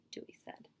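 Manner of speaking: plain reading voice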